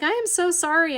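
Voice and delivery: high-pitched retold sorry; apologetic. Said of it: "And I felt so bad".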